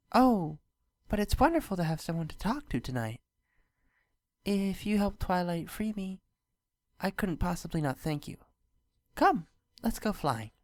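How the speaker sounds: a shy, pleased princess greeting; Luna voice. A narrator's reading. Luna greets Spike, lightly voiced and shy.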